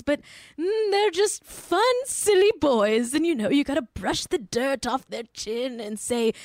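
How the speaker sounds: high, doting mock voice, slowing at the end; fawning parody defending the boys; mocking Lisa Vanderpump. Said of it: "you literally just said in the reunion episode".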